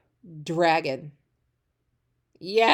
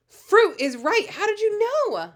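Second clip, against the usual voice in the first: much higher and louder, delighted disbelief; mock amazement; 12 semitones higher, 4 dB louder than usual. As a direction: gush with pretend astonishment at the child. Teacher praising unseen child.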